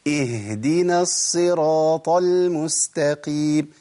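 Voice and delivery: very slow chanted "اهدنا" with a full, held h; fully articulated ha. Shown as a demonstration. Teacher models proper recitation, fully articulated ha. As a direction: recite "اهدنا" drawn out, voicing the h from its place. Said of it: "لازم أمكن لمخرج الهاء".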